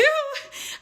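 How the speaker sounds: sudden high laugh; amused laugh. Real, not acted. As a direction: crack up briefly at an accidental innuendo. Amused by her accidental innuendo.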